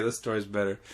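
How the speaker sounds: ordinary talk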